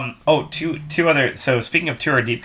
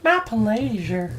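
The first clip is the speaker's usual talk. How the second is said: slow, higher "My pleasure" stretched out sweetly; drawn-out sweet reply; 10 semitones higher, much slower than usual. Retold or imitated. Cashier girl thanked by customers, slow sweet high reply. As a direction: cashier girl sighing "My pleasure" slowly, pitched up. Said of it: "she'd be like".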